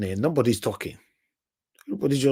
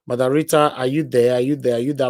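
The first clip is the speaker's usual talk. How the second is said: loud, raised calling voice; calling out on the line; 5 dB louder than usual. Real, not acted. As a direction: call out to a silent line. Host calling absent caller on line.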